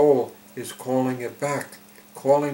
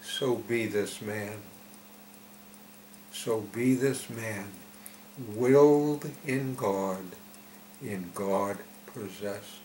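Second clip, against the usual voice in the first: slow, measured prayerful reading; solemn verse recitation; slower than usual. Acted. Preacher reading the message to listeners, slow solemn recitation. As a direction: recite it line by line, with pauses.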